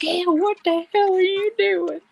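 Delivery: high, scornful "ew, what the hell"; disgusted quote. Retold or imitated. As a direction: act grossed out by a friend. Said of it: "and you're like".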